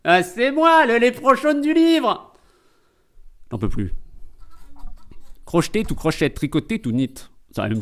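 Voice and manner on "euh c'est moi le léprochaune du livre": high, cartoonish little creature voice; leprechaun voice. Pop-up grandpa greeting Eric from the book, high leprechaun voice. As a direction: pop up with a squeaky greeting, stretching the last words.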